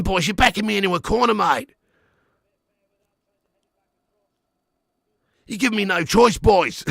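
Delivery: put-on a cornered Australian manager pleading with his boys; panicking Big Ange. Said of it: "I can imagine he'd be panicking pretty bad".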